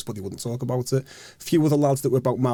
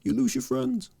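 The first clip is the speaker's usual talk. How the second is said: much higher put-on old man's voice; old geezer; 13 semitones higher, 3 dB quieter than usual. Old men on the veranda call to the panicking lads, high put-on voice. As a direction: play the old man calling down from the veranda. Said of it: "these old geezers were like".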